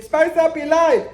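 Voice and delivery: high, loud and drawn out; sassy sing-song. Retold or imitated. To herself in the bedroom, high sassy sing-song. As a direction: belt it out, hyped to spice things up. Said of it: "I was like".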